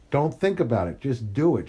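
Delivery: ordinary delivery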